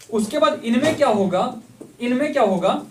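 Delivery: ordinary delivery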